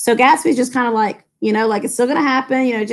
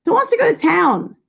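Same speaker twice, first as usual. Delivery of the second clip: higher, insistent demand; insistent Daisy; 9 semitones higher than usual. Acted. Daisy demanding the group go to town, higher and insistent. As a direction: push the question on the room. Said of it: "demanded Daisy insistently".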